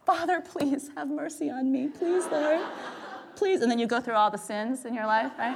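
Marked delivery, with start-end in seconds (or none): 0.1-3.6 slow, higher, pleading prayer to God; hammed-up desperate prayer mid-marathon; mock prayer. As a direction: beg God dramatically, half joking. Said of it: "it's like".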